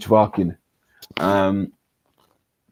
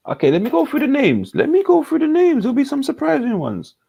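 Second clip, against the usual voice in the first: much higher, playful squeak; giddy high-pitched announcer; squeaky high voice; 4 dB louder than usual. Acted. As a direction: pipe it up like a cartoon.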